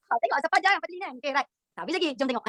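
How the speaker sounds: ordinary talk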